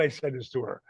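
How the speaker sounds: ordinary talk, nothing marked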